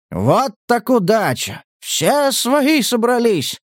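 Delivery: high, slow, gleeful crowing; merry mockery. A narrator's reading. Hunter mocking captive with rifle at his head, merry mockery. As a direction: exclaim it merrily, stretching the words. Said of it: "весело констатировал охотник".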